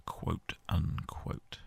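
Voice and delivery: ironic whisper under the breath; whispered aside. Real, not acted. Gamer to his viewers, slow ironic whisper. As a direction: drop to a slow sly whisper.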